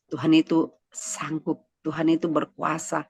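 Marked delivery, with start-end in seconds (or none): none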